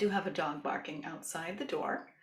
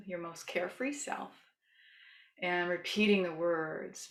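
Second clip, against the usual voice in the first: soothing yoga teacher. Shown as a demonstration. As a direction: gently lead a loving kindness meditation. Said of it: "as I start to use my yoga voice".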